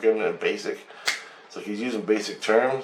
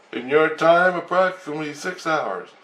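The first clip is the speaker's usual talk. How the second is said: raised, halting alien voice; high alien voice; 7 semitones higher than usual. Retold or imitated. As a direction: speak like a lipless alien. Alien answers the question.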